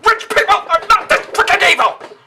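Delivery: high, loud outraged yell; furious shout. Acted. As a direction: scream it at the screen. Ranting at the film's villains.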